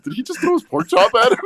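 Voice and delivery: very high, loud incredulous question, half laughing; stunned family. Retold or imitated. Family at the cookout watching the pork chop fly, high and incredulous. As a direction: gasp it out way up high in disbelief. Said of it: "all the family just looked over like".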